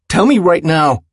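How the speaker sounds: high, loud, emphatic order; angry demand. A narrator's reading. Willem pushes his reluctant friend, angry demand. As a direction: press him hard for it.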